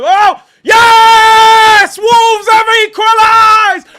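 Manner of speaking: huge high-pitched roar, stretched out; ecstatic goal shout. Real, not acted. Streamer erupting as Wolves equalise, ecstatic shout. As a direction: scream the goal in real elation. Wolves score during live watchalong.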